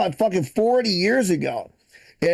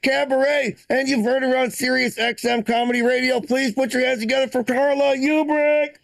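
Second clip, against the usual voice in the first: announcer intro; 4 semitones higher than usual. Acted. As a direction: hype up the next comic like a club emcee. Host introduces next comic.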